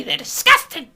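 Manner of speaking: high, loud scandalised cry; mock outrage. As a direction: fake shocked prude.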